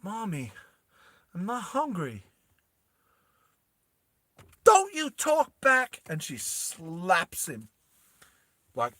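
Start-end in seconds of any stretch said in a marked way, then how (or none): 0.0-2.3 put-on small, higher child's voice; soft, timid plea to mommy; little kid. Little Vas answers his abusive mum, soft high child voice. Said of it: "and then little kid, Vas goes". 4.6-6.0 high, slow scolding; shrieked maternal reprimand; abusive mum. Acted. Abusive mum before she slaps her kid, high drawn-out scolding. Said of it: "she's abusive".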